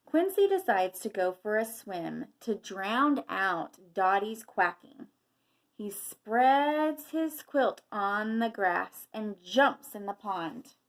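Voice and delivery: picture-book narration, projected and measured; reading to children. A narrator's reading. Teacher reading a quail story to children, storybook narration. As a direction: read it out with lively emphasis.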